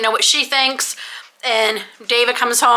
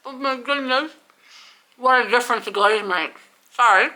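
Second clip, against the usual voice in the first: talking through a mouthful; mouth full. Real, not acted. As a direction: speak around a bite of glazed roll. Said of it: "talking with my mouth full".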